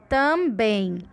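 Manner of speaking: "Também" pronounced emphatically, weight on "bem"; word said out loud. Shown as a demonstration. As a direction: sound out "Também" with a strong final "bem". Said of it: "precisa falar a palavra alto".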